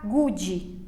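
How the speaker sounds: "good" said as "goo-djee"; Brazilian-style "good" with an extra I ending; added final I. Shown as a demonstration. Teacher shows what to avoid, "good" as "goo-djee". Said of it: "A gente vai e coloca um I".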